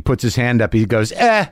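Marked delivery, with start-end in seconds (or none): 1.1-1.5 high-pitched mimicked brush-off; dismissive grunt. Comic mimics a colleague icing him, high dismissive grunt. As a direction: imitate someone icing you with one short sound. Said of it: "he just ices me".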